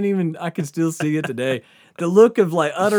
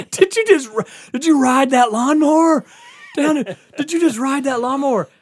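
high, loud yell of disbelief; imitated incredulous cop; 11 semitones higher, 7 dB louder than usual. Cop asking about the ridden lawnmower, imitated incredulous yell. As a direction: stammer into a hollered, disbelieving question. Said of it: "he had to say it out loud".

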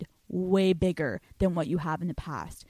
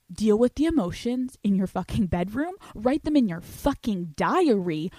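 ordinary talk, nothing marked

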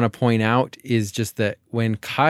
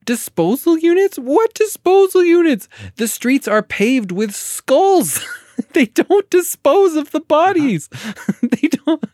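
much higher and louder, laughing disbelief; incredulous outburst; 5 dB louder, slower than usual. Real, not acted. Mocking the film's logic to a co-host, high, loud, incredulous laughing. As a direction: blurt it out like you can't believe the nonsense. Incredulous rant about skulls.

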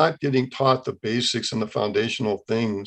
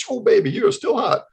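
high, playful flirting; playful flirt to wife in kitchen; flirty compliment; 10 semitones higher than usual. Retold or imitated. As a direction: tease his wife lovingly.

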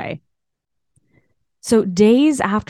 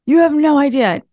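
high, bursting news to her husband; blurting out the big news, pitched high; excited retelling; 8 semitones higher than usual. Retold or imitated. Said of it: "I go running inside the cottage".

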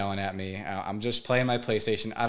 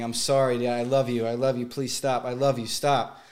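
desperate pleading; 2 semitones higher, 4 dB louder than usual. Retold or imitated. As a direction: plead with your father, voice straining. Boy pleading with abusive father.

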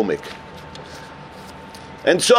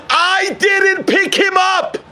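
slow, much higher, raised voice full of regret; anguished confession; 9 semitones higher, slower than usual. Retold or imitated. Friend confesses to Rabbi Ginsburg he passed the man by, slow anguished cry. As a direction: drag out each word like a painful admission.

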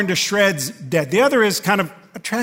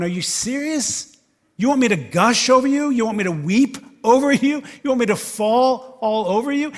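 higher, louder scoffing disbelief; indignant Simon; 6 semitones higher, 6 dB louder than usual. Retold or imitated. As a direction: Simon balking, voice raised. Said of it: "Simon must have been going".

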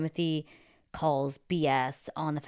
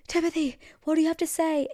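much higher, excited reporter voice; breathless paparazzo; 12 semitones higher, 4 dB louder than usual. Retold or imitated. Paparazzo sprinting after Timothy, high-pitched eager shout. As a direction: chirp the question up high as you run.

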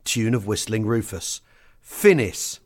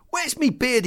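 gabbled; 11 semitones higher than usual. Retold or imitated. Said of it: "he gabbled".

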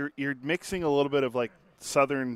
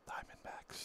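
whisper; 23 dB quieter, slower than usual. Pretend. Mock whispered quiz answers.

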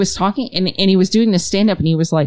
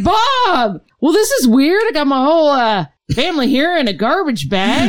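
awkward greeting; 8 semitones higher than usual. Retold or imitated. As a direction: sound sheepishly surprised, giggling at the end. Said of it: "He's like".

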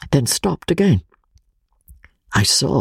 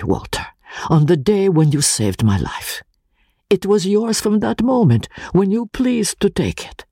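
higher, trembling earnest friend swearing his word; solemn earnest vow; 4 semitones higher than usual. Acted. Pesca swears his life to Walter, trembling earnestness. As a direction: give your life over, solemn and fervent.

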